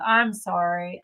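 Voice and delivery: usual speaking voice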